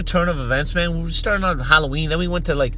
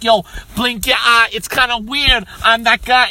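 loud, higher mock-rapper bluster; hammed-up gangster rapper; 4 semitones higher, 5 dB louder than usual. Uncle embarrassing his nephew, loud mock gangster voice. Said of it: "start rapping for the party".

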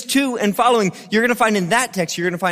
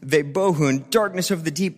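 ordinary delivery

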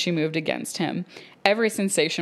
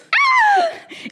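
burst of laughter; 9 dB louder than usual. Real, not acted. Reacting to steamy passage.

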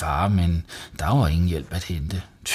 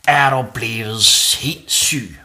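slow, loud, high outrage; angry shout; 5 semitones higher, 6 dB louder than usual. A narrator's reading. Mum seeing the flooded bathroom, shouting. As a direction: shout it drawn out in disbelief. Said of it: "råbte mor videre".

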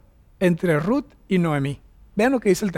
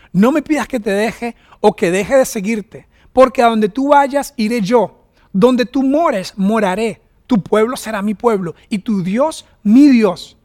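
slow, raised, declaiming the verse; solemn scripture reading; 2 semitones higher, 4 dB louder, slower than usual. Acted. Preacher reading Ruth's vow to the congregation, solemn and slow. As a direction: read it like a sacred vow.

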